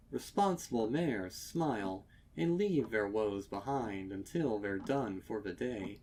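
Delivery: ordinary delivery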